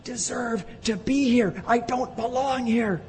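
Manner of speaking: high, pleading voice of a doomed man; despairing confession. Retold or imitated. Preacher voices Isaiah's fear before God, high pleading despair. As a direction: plead your unworthiness, fading at the end. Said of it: "He's saying".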